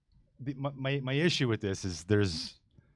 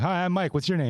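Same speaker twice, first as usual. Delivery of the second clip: bright high greeting; 10 semitones higher than usual. Retold or imitated. Retold greeting to woman at bar.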